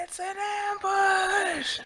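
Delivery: mock alarm. Player jokes about danger.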